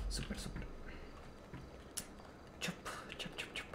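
hushed, whispered call; whispering. Real, not acted. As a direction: whisper the dog's name softly. Calling the dog quietly.

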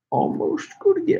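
playful high echo of his own words; high-pitched repeat. Real, not acted. Streamer filling time before a prank call, high-pitched repeat. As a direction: jump up to a very high voice.

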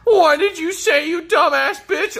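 squeaky high voice, spitting the insult; high mock insult.

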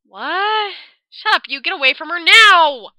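loud, sharp angry shout; furious order. Acted. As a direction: drive her away now. Ren orders Yuta away.

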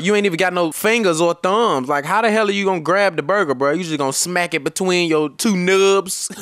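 usual speaking voice

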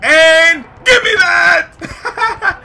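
high, loud, stretched-out holler; snatch it with your voice; triumphant yell. Real, not acted. Player grabs a rebound over the mascot, triumphant yell.

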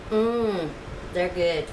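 ordinary delivery

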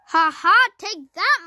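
loud, high triumphant jeer; gloating taunt. Real, not acted. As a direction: crow over the beaten rival. Taunting the opposing team.

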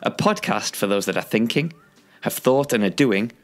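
loud, upbeat host announcement; podcast intro read. Acted. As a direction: sell the show like a radio host. Podcast intro read over music.

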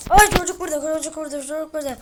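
high, loud, then rushed repeats; startled gamer yelling that the monster is here; panicked alarm. Real, not acted.

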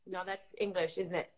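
ordinary talk

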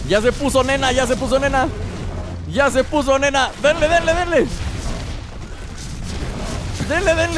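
high, repeated battle calls; excited urging. Real, not acted. Player pushing teammates to keep attacking, excited urging. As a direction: keep egging them on, pitch climbing.

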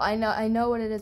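ordinary delivery

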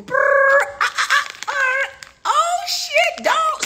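loud, very high stammering; hammed-up shock. Reacting to what Google showed, loud shrieky stammer.

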